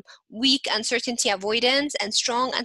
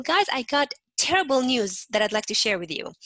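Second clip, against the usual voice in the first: mismatched upbeat delivery of bad news; excited high tone; 3 semitones higher, 3 dB louder than usual. Shown as a demonstration. Teacher showing wrong tone for terrible news, excited. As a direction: announce it brightly, as if thrilled. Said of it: "high tone when you're very excited".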